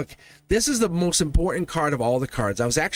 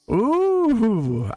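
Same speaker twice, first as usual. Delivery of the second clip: delighted ooh; 8 semitones higher, 3 dB louder than usual. Real, not acted. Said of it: "you can hear my smile".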